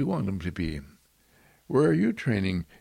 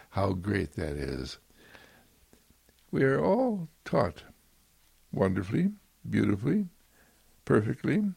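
usual speaking voice

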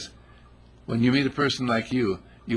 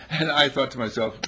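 ordinary delivery

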